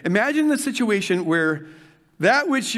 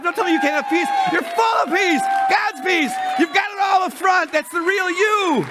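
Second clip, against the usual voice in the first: revival-pitch shouting; fiery preaching; 8 semitones higher than usual. Real, not acted. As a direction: preach it with the fire on you. Preaching fire, "feeling some fire.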